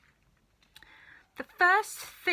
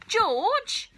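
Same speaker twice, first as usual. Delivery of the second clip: much higher, louder urgent call; calling George; 13 semitones higher, 7 dB louder than usual. A narrator's reading. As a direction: call him over eagerly. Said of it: "Mister Cranky shouted".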